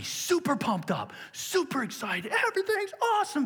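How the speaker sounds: squealing high mock enthusiasm; mock excited. Preacher imitating a passionate person on a high, in mock delight. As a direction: lift the voice way up like everything's great. Mocking passionate people's highs.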